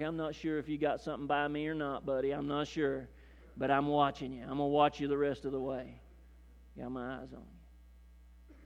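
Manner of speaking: soft, suspicious low warning; menacing. Retold or imitated. Airport guard eyeing the traveller who got through, softly menacing. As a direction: lean in and warn him quietly. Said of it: "with this menacing look like".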